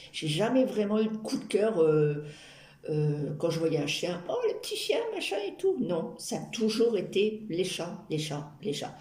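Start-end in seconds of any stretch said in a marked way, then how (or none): 4.3-5.8 put-on high gushing baby-talk voice; cooing at a puppy. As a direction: coo it like you've spotted a puppy. Cooing over a dog.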